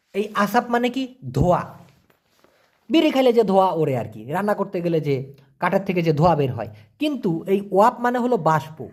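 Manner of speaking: ordinary talk, nothing marked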